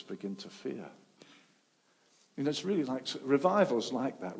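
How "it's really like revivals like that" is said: ordinary delivery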